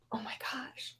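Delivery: breathless whisper, no voice; stunned whisper. Retold or imitated. Spotting a famous author at the next table, whispering. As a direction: whisper it in awe across the restaurant. Spotting Neil Gaiman at dinner.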